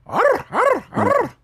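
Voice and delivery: high rolled-R dog bark; Mexican stray dog. Acted. Comic doing how stray dogs in Mexico sound, high trilled bark. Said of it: "stray dogs in Mexico".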